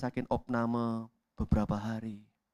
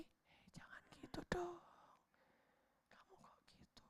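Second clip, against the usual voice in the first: much higher, breathy whisper fading to almost nothing; 22 dB quieter than usual. Acted. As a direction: barely breathe it, a conscience nearly silenced. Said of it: "Suaranya makin pelan lagi".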